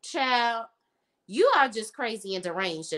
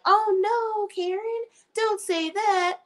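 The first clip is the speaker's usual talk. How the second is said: high, slow pleading "oh no Karen"; mock pleading friends; 10 semitones higher, slower than usual. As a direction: coax her like a fragile friend. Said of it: "Danny and Sabrina are like".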